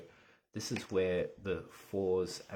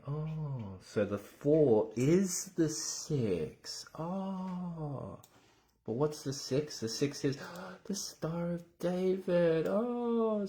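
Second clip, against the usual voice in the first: surprised; 11 semitones higher, 7 dB louder, much slower than usual. Acted. Mock discovery while teaching.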